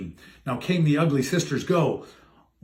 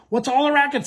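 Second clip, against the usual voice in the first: mild cry; 7 semitones higher, faster than usual. Acted. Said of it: "Cindy cried".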